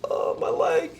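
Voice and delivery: injured player whimpering about her leg; mock whimper. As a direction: whimper like a hurt patient. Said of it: "She's like".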